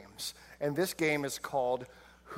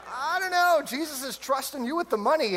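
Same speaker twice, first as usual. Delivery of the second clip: high teasing disciple; 9 semitones higher than usual. Retold or imitated. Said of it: "Somebody's like".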